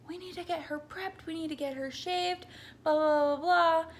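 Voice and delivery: high, urgent imitation of a panicking nurse; mock-frantic nurse barking orders; hammed-up panicky nurse voice; frantic yell. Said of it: "she is yelling".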